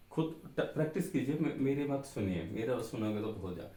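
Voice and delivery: usual speaking voice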